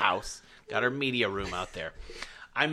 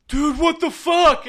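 loud, high, drawn-out mock protest; high-pitched slow wail of indignation, acted; imitated outcry; 9 dB louder than usual. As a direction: mimic an outraged kid yelling. Imitates the son's outraged outcry.

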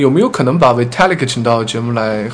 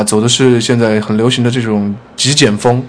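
normal talking voice

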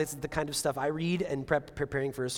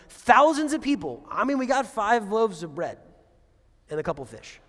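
exasperated protest; 5 semitones higher, 6 dB louder than usual. Retold or imitated. Disciples protesting to Jesus, loud and exasperated. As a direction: throw up your hands at the sheer number.

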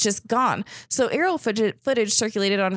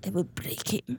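put-on hushed, whispery Russian-accent menace; Ivan Drago; 8 dB quieter than usual. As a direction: softly threaten in a Russian accent. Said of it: "Ivan Drago".

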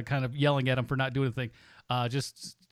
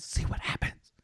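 echo effect; 4 dB quieter than usual. Acted.

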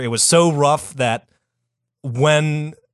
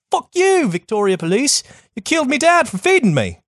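mock Australian accent; 11 semitones higher than usual. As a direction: yell it like an angry Aussie kid. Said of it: "She's like".

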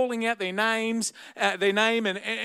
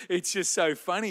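ordinary talk, nothing marked

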